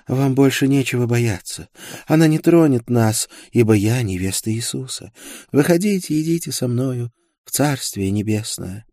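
high-voiced religious zealot; devout young woman. A narrator's reading. Isolde invites prisoners into the Kingdom of Heaven, high and devout. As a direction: lift the voice into serene, high conviction. Fanatical bride of Christ speaks.

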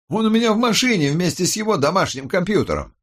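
high, quick character voice; eager subordinate. A narrator's reading. Officer reports to his boss about the phone, high and quick.

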